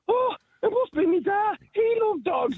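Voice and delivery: put-on high, eager girl's voice; mock girl. Mimicking the gullible girl at the psychic, high and eager. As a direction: pitch it way up, gushing. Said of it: "one of the girls went".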